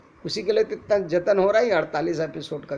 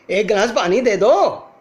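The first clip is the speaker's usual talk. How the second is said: loud, higher repeat with more intensity; urgent, slightly angry; 4 semitones higher, 9 dB louder than usual. Shown as a demonstration. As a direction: demand it from someone who didn't hear. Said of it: "हल्का सा urgency, गुस्सा या थोड़ा सा intensity". (हल्के गुस्से और urgency से, ज़ोर से)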